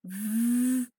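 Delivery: long, loud buzzing V; voiced v sound. Shown as a demonstration. Teacher has students check their voice box, voiced V held long. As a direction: hold V with the voice box vibrating. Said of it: "definitely a voiced sound".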